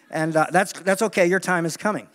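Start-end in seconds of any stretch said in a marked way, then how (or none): none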